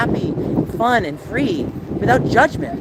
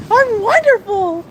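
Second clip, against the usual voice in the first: high, fake-bright chirp, much higher and slow; fake cheery; 3 dB louder, slower than usual. Streamer showing fake smiles, mock cheery high squeal. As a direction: plaster on a fake happy face and squeal it.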